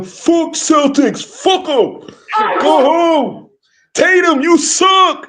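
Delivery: high, laughing fan chant, slow; mock hyped fans. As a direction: imitate rowdy Knicks fans jeering. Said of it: "They are so hyped".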